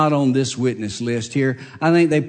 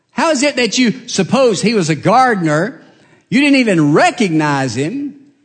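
louder, higher, hectoring cross-examination; badgering prosecutor; 5 semitones higher, 3 dB louder than usual. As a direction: pin the witness down like a hostile lawyer. Prosecutor badgers the hostile witness.

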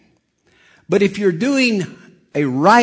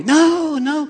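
high, excited protest; high-pitched, drawn-out objection; excited receptionist; 12 semitones higher than usual. Retold or imitated. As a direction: imitate her flustered high "no". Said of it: "And she's all excited".

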